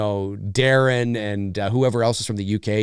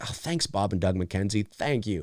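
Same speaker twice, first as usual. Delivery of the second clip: dry sarcastic thanks; 5 semitones lower, 8 dB quieter than usual. Retold or imitated. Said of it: "I'm like".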